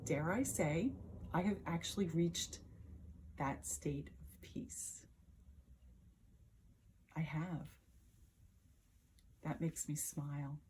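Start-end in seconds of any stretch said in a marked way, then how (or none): 1.3-10.6 softly, at an unhurried inward peace; hushed serenity. Acted. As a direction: let the voice drop, savouring a quiet moment. Recalls reaching peace, pleased, inward.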